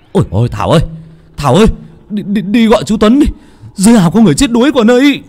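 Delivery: high, fast, frantic calling for help; panicked shout. Acted.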